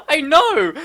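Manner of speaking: real excited I know. Real, not acted. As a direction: blurt it out mid-laugh. Reacting to Big G child.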